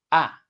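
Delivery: "ah" dropping sharply from high; short, loud falling "ah"; fourth tone. Shown as a demonstration. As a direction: cut "ah" downward like a command. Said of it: "let's sing ah with the four tones".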